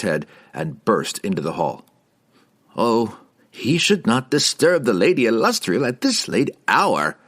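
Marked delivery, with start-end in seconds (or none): 2.8-7.2 higher, fussy wizard objection; protesting. A narrator's reading. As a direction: protest in mild dismay. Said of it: "Harkle protested".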